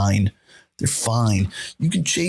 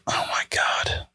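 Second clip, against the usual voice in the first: hushed, voiceless disbelief; awed whisper; stunned whisper; 4 dB quieter than usual. Real, not acted. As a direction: whisper it in amazement. Stunned by the trick on screen.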